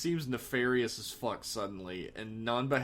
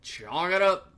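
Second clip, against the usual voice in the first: louder, emphatic repeat; loud call; 7 semitones higher, 8 dB louder, slower than usual. Real, not acted. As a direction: call it out for the record.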